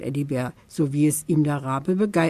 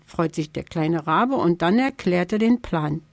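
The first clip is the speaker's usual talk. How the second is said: plain reading voice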